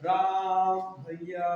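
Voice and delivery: very slow "राम भैया" with the vowels stretched; prolonged call. Shown as a demonstration. Lakshman calling out to Ram, drawn out. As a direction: draw the call out like in Ramlila. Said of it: "बीच में जो समय लगता है ना ज्यादा बोलने में".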